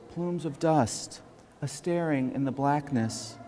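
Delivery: slow, measured poem recitation with lifted pitch; deliberate poet's cadence, phrases drawn out and set apart; poetry reading. Acted. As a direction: read it as solemn verse, pausing after each line. Reads own poem aloud.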